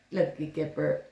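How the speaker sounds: usual speaking voice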